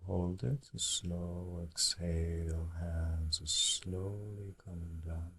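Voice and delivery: very soft count between breaths, then slow; guided breathing exercise. Real, not acted. Teacher breathing along with meditators through the count, guided breathing. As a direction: lead the breath very softly, stretching out the exhale.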